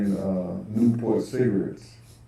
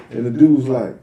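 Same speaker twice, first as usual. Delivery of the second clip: normal talking voice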